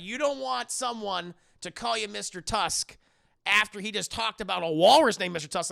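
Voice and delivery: normal talking voice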